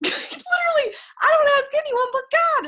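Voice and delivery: giggling through the words; laughing. Real, not acted. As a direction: laugh it out, high and bright with delight. Laughing about asking only God.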